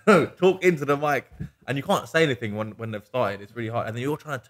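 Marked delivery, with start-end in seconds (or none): 0.1-1.3 higher, louder half-laughing insistence; mock exasperated plea. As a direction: beg them to use the mic, exasperated. Retold plea to speaker at wedding.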